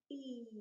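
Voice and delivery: E held as a steady, stretched ee; long vowel E. Shown as a demonstration. Teacher gives a listening task, E stretched out. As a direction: sustain the letter name E. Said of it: "The long vowel sound E".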